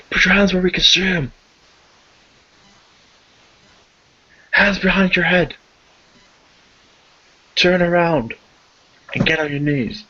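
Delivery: high, loud and fast, barking orders; shouted police commands. Acted. Police officer shouting at the suspect to surrender. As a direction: yell it like a cop with a gun drawn. GM voices cops shouting at player.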